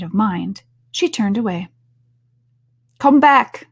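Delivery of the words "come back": high, loud call after her. A narrator's reading. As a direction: call out to someone leaving. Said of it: "the caterpillar called after her".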